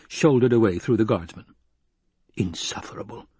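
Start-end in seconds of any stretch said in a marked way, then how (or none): 2.4-3.3 breathy private scorn; whispered thought. Acted. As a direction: whisper it to yourself. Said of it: "the Baron thought".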